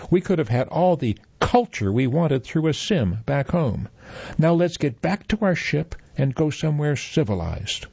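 high, sniffy disdain; snooty complaint. Acted. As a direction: look down on this dump. Parent complaining about vacation.

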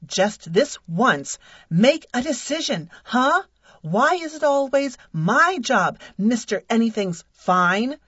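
loud, high-pitched furious tirade; angry rant. Acted. Wife berating her indecisive husband, loud and furious. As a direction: tear into him, shouting.

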